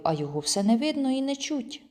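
usual speaking voice

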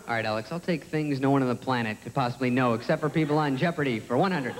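low, even know-it-all voice; deadpan low contestant voice; game show champ. Acted. Plays smarter champion contestant Gurn.

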